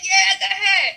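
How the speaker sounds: high, loud cheer; root for her from the street. Retold or imitated. Cheering on the cyclist climbing the hill, high and loud. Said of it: "I was like".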